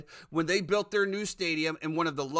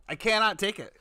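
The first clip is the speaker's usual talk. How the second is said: higher, fed-up outburst; exasperated; 5 semitones higher, 4 dB louder than usual. Real, not acted. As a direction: throw it out in real exasperation. Exasperated end of ticket rant.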